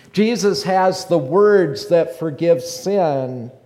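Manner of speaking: loud, rising sermon delivery; preaching. Acted. Preacher to congregation, loud fervent preaching. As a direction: preach it with full force to the congregation.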